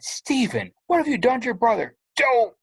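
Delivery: high, fast accusing mother; alarmed mom. Acted. Mother bursting in, accusing Steven, high and fast. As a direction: demand it like a panicked mom.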